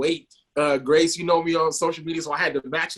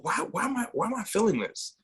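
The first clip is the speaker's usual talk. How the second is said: higher-pitched, mildly bewildered questioning; puzzled self-questioning; 8 semitones higher, 4 dB quieter than usual. Retold or imitated. Looking back on his young feelings, puzzled. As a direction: recall asking yourself, puzzled.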